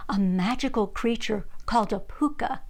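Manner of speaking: ordinary delivery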